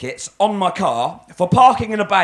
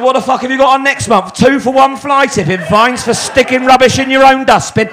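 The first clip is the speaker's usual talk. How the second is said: loud, higher mocking jibe; sarcastic rant, louder and higher; 6 semitones higher, 7 dB louder than usual. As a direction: blast the joke at him. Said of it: "Don't be sarcastic".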